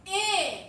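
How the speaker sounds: a held "eh" pronounced "ih", as in sit; short I sound. Shown as a demonstration. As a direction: model the mistaken vowel, "eh" said as "ih". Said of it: "I noticed you said".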